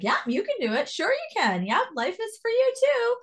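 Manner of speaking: rising pep talk from a father figure; encouraging mentor. Retold or imitated. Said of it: "he was this person that was always like".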